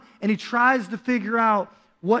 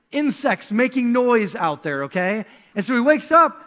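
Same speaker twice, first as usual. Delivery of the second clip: usual speaking voice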